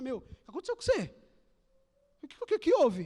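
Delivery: an amazed friend asking, pitch rising at the end; surprised friend. Retold or imitated. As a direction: play the astonished friend who barely recognizes you. Unbeliever asks what happened. (amigo espantado, voz subindo no fim)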